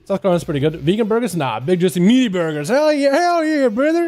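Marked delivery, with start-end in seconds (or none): 2.7-4.1 high, hammed-up Southern accent. Streamer cheering about meaty burgers, hammed-up Southern accent. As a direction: cheer like a Southern good old boy. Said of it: "Why am I giving a southern accent".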